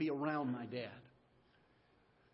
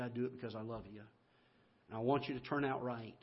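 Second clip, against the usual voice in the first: softer, gentle fatherly reassurance; loving dad; 4 semitones lower, 5 dB quieter, faster than usual. Retold or imitated. Father tells son he punishes out of love, gently. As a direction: explain the whipping softly, out of love.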